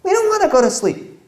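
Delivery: put-on children refusing bedtime, high and whiny; whiny kid. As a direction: pout it out like a stubborn kid. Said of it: "They'll say".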